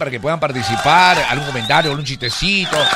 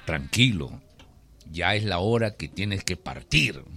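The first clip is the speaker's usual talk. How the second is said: low, softer voice of Death; deep, quiet summons; 7 semitones lower, 7 dB quieter than usual. Acted. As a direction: deliver the sentence calmly and low. Death tells farmer to go.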